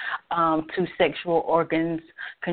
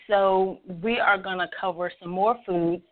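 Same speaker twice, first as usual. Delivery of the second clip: ordinary delivery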